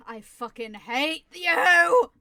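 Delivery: slow, loud furious yell. Real, not acted. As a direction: scream it in frustration. Angry at game enemy.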